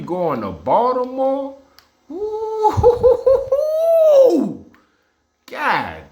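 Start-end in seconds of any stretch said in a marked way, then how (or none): 2.1-4.6 high squealing laugh, louder; a real burst of gleeful laughter; delighted laugh. Real, not acted. Fan reacting to Derrick Henry going to Baltimore, squealing with laughter.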